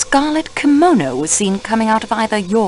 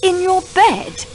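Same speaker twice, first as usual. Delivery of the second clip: surprised; 9 semitones higher than usual. Acted. Detective asks, surprised.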